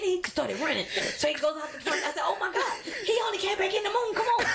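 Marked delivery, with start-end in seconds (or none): 2.2-4.5 laughing disbelief. Retold or imitated.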